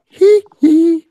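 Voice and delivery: very high, loud "hee-hee" squeal; piercing falsetto yelp, played for fun; mock squeal. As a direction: squeal it out like a pop-star ad-lib.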